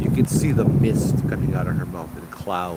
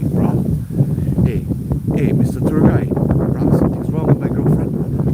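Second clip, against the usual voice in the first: louder, urgent calling out to the guide; panicked boyfriend; 4 semitones higher, 6 dB louder than usual. Retold or imitated. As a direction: imitate a freaked-out boyfriend, hammed up. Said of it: "starts kinda freaking out".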